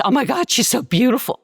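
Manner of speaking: high, quick, gushing voice; mock gushing admirers. People gushing over her looks, high and quick. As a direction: rattle it off like cooing onlookers. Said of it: "people would say was".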